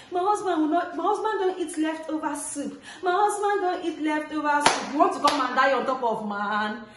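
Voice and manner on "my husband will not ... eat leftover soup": high, whiny mock wife voice; lightly voiced fussy wife; mocking other wives. A mocking mom imitating women who say this about their husbands, whiny. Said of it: "Some women will be like".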